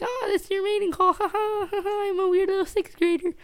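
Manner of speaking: very high silly falsetto; mock them in a squeaky little voice; exaggerated high-pitched taunt; teasing mockery. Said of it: "I'd make jokes to them".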